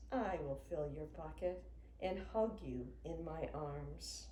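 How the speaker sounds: usual speaking voice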